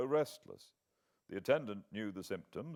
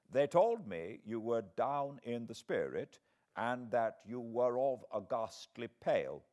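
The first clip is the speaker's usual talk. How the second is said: put-on gentle elderly scholar, taking his time; old foreign professor; slower than usual. A narrator's reading.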